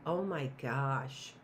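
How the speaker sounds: normal talking voice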